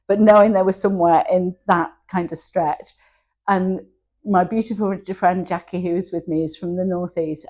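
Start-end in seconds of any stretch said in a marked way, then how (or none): none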